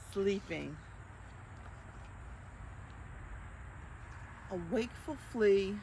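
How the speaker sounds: lightly lilting picture-book narration; reading to children. A narrator's reading. Librarian reading the book to children, gentle storytime. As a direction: read it gently to little listeners.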